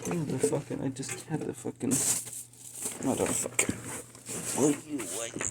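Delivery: frantic search, cursing under breath; hurried, panicky muttering. Real, not acted. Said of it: "I'm getting frantic now".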